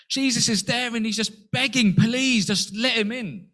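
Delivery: hammed-up beggar's voice, slow on the end; mock pleading. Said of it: "in the most unbiblical way".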